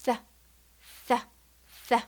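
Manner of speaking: "Th" three times, tongue between teeth; th sound demo. Shown as a demonstration. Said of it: "Let's practice".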